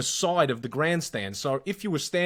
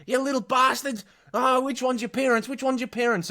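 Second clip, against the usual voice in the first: angry bald man; 11 semitones higher than usual. Retold or imitated. Bald man yelling at the kids from the grandstand. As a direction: roar it at the kids who spat on you. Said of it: "he just went".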